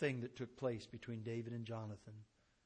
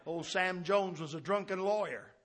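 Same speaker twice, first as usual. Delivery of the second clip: ordinary talk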